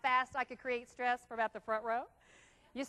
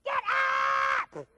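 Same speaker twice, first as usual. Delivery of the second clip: high, loud barked wake-up at a partner; guttural shout; 9 semitones higher, 5 dB louder, much slower than usual. Retold or imitated. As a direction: yell the spouse awake. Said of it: "wake your partner up like that".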